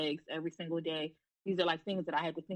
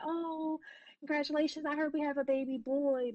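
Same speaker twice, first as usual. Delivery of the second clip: imitating an oblivious nurse, pitched much higher; head nurse's misplaced congratulations, mocked much higher; mock cheery nurse; 8 semitones higher than usual. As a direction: sing it out too sweetly, clueless of the loss.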